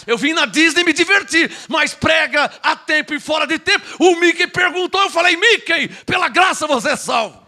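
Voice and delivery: high-pitched comic outburst; excited preaching shout. Acted. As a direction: tell it at a shouted, climactic pitch. Comic story rising to preaching shout.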